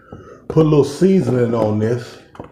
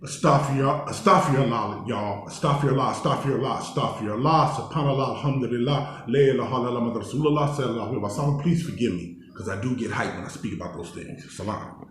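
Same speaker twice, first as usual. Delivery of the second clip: softer, subdued recited prayer; real penitent murmur; 6 dB quieter, faster than usual. Real, not acted. Speaker asking forgiveness for getting hyped, hushed penitence.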